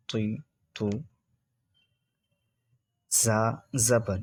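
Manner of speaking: measured teacher recitation with long pauses; patient classroom drill; slow spelling drill. Shown as a demonstration. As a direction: spell each syllable out for students to repeat.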